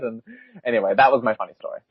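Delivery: normal talking voice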